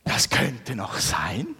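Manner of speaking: slow whisper to himself; whispered thought. Retold or imitated. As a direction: think it aloud in a hushed whisper. Ich dachte mir. (langsam geflüsterter Gedanke)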